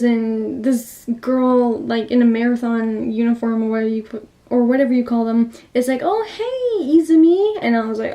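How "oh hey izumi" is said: high-pitched, chirpy mock hello; acted friendly greeting. Marathon girl greeting Izumi, acted chirpy hello.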